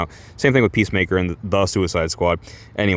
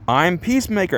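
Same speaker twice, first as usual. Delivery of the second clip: higher proud self-introduction; heroic intro; 10 semitones higher than usual. Retold or imitated. Reading the hero's introduction panel, proud and high. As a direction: announce yourself like a hero.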